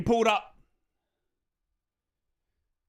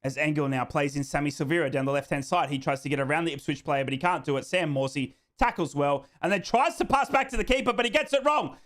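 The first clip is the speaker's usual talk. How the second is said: ordinary delivery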